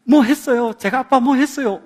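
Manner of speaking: a hint of an eager little kid; lightly voiced child. Youngest son telling dad what he did, lightly voiced child. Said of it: "애가".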